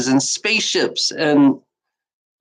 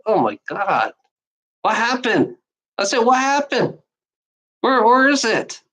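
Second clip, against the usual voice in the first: higher-pitched mock dismay; acted disbelief; 6 semitones higher than usual. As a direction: act out baffled disappointment, pitch climbing. Acts out his baffled disappointment.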